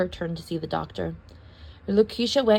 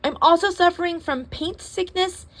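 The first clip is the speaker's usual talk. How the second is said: fussy complainer; 7 semitones higher than usual. Acted.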